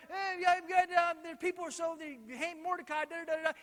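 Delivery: high-pitched mock whining; whiny. Haman griping to his wife about Mordecai, whiny. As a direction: complain in a high, babbling whine. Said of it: "He's kind of a whiner".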